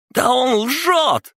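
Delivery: very high, drawn-out shriek; hysterical squeal. A narrator's reading. Panicking son calls prisoner a liar, squealing. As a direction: squeal it at the top of your voice. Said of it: "завизжал Николас".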